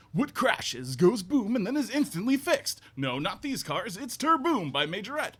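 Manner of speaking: high, loud hammed-up kid ad voice; car commercial. Guest reciting his childhood car commercial, hammed-up. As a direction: sell it like an excited ad kid. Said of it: "it was like a car commercial".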